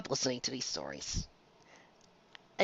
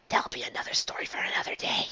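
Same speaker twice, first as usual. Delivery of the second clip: hushed aside, breathy and quick; whisper; 4 dB quieter, faster than usual. Real, not acted. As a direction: whisper it as a secret teaser. Teasing a future story aside.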